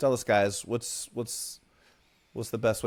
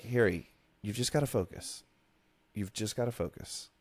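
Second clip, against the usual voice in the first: softer, calmer Hermione; realistic Hermione; 2 semitones lower, 7 dB quieter than usual. Shown as a demonstration. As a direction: say it like a real girl, softly. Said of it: "When in real life, she's probably like".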